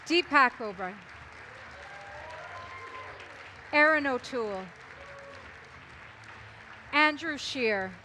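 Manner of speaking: announcer calling names; announcer introductions. Acted. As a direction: introduce each candidate to applause. Calls out candidates onstage.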